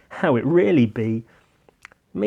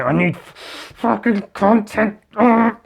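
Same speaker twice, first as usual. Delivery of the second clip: higher, slower exasperated outburst; hammed-up annoyed groan; mock frustration; 8 semitones higher, 5 dB louder, slower than usual. Frustrated outburst about content.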